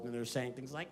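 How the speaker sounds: ordinary talk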